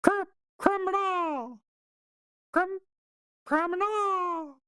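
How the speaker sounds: very high, drawn-out squeaked magic word; mouse squeaking. Acted. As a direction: squeak slowly, stretching the last syllable. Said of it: "he's like".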